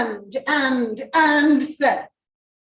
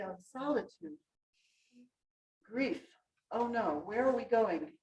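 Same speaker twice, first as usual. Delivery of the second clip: mock gloom; 2 semitones lower, 13 dB quieter than usual. Shown as a demonstration. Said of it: "Sonorous wings".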